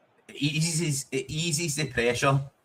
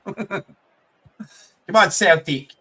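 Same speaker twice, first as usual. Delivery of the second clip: loud, higher shout of support; cheering shout; 6 semitones higher, 6 dB louder, slower than usual. Real, not acted. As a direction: yell for Celtic to get on with it. Urging the team on.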